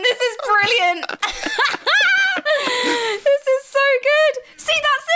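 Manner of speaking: high, laughing delight; delighted laughter. Real, not acted. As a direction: crack up while praising. Cracking up at the nanny voice.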